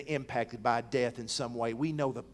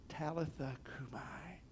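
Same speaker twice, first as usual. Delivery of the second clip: slow, hushed Aramaic words into her ear; whispered Aramaic; 14 dB quieter than usual. Acted. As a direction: breathe Talitha cumi softly and slowly. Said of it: "he whispers in her ear".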